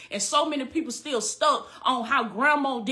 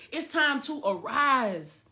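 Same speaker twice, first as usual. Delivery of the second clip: slow solemn declaration; slower than usual. Acted.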